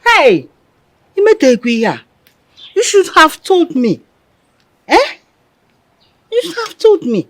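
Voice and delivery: high, loud outburst; indignant scolding. Acted. Mother scolding her daughter for keeping it secret, indignant.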